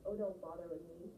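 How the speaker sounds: normal talking voice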